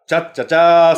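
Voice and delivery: loud, high catchphrase greeting; borrowed catchphrase. Retold or imitated. Reading a listener's greeting as another comic's gag, borrowed catchphrase. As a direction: belt out someone else's gag greeting. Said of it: "もう人の。".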